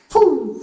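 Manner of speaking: loud, high whoosh; imitated swoosh of running away; sound effect. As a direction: burst it out like something shooting off. Imitating the sound of his escape.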